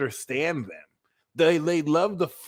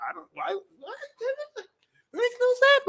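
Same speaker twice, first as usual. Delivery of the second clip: very high squeaky disbelief; hammed-up high-pitched incomprehension; mock bafflement; faster than usual. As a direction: squeal it in broken bits, utterly baffled. Acting baffled Gemini at crying Pisces.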